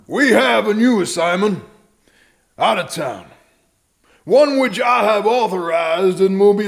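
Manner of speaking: high, loud barked orders; rally the deputies with force; commanding orders. Acted. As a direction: sheriff shouting orders to his deputies. Sheriff gives orders to deputies.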